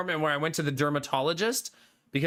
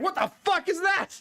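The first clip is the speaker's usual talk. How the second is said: much higher, startled exclaim; mock shocked doctor; 12 semitones higher than usual. Doctor seeing the streamer's shingles, mock shocked. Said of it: "She's like".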